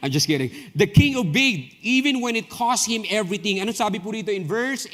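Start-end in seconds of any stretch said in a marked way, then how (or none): 0.7-3.6 preaching. Acted. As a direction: proclaim it to the congregation. Preaching proclamation.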